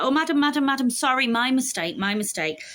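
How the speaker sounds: grovelling waiter. Retold or imitated. Ticket seller caught overcharging apologises to foreign resident, fawning and high. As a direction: backpedal hard, all sugary apology, then settle.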